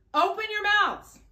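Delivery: loud and high, rallying; teacher calling out the cue. Shown as a demonstration. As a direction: ring it out so they stand up. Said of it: "every time he says open your mouth".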